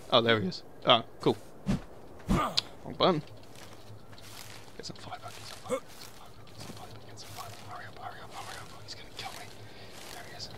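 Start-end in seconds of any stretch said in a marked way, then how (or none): 4.8-10.5 frantic breathless whisper, far softer; whispered panic while hiding; panicked whisper. Real, not acted. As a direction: hiss the urgent words under your breath.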